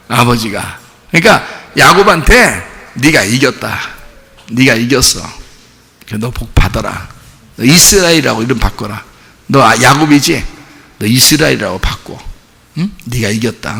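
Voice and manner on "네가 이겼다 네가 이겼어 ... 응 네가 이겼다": softer, lower, tender blessing; God's gentle concession. Acted. 야곱을 달래며 이름을 바꿔 주는 하나님의 부드럽고 다정한 목소리. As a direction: murmur it like a father letting his son win. God soothing Jacob, conceding.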